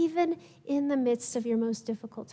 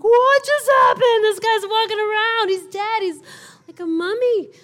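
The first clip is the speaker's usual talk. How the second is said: much higher and louder, gasping in shock; stunned disbelief; 9 semitones higher, 10 dB louder than usual. Retold or imitated. Onlookers watching the dead man walk out, high astonished voices. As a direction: play a bystander who can't believe his eyes.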